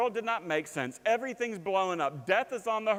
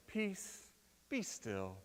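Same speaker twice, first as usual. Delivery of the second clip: mild soft reading; 3 semitones higher, 8 dB quieter, much slower than usual. Shown as a demonstration. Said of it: "I don't like the translation here".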